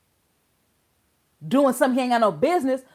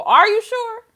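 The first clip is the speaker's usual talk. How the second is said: incredulous; 10 semitones higher, 6 dB louder than usual. Real, not acted. Incredulous retort to judge.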